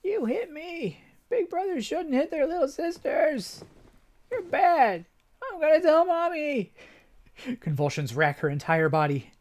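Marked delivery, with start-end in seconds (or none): 0.0-6.7 put-on high, whiny child, slow; a hurt kid threatening to tell Mommy; high sulky child voice; tattling little sister. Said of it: "reliving memories of early childhood".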